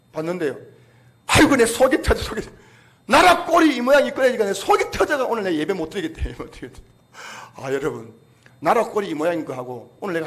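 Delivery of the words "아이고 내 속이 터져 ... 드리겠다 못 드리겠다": loud, high exasperated grumbling; upset church member refusing to worship; exasperated complaint. Retold or imitated. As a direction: vent the frustration loudly. Retold angry church member.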